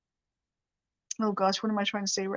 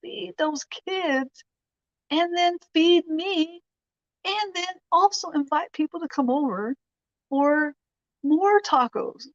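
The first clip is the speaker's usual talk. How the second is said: amazed inner thought; 8 semitones higher than usual. Retold or imitated. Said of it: "I'm thinking".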